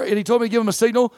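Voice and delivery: ordinary delivery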